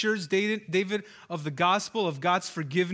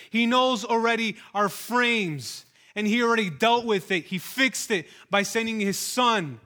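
louder, rising impassioned preaching; fervent gospel climax; preaching at full force; 4 dB louder than usual. Acted. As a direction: preach the hope with fire.

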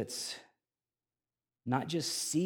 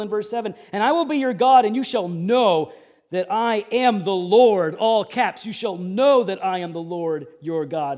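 preaching at full force; 8 semitones higher, 12 dB louder than usual. Acted. Pastor declaring God's plan to the congregation, loud preaching. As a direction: proclaim it to the whole room. Preacher reading promises aloud.

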